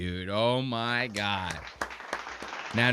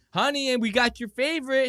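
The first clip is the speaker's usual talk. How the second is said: mock mom voice; 11 semitones higher, 3 dB louder than usual. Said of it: "a mom comes home and they go".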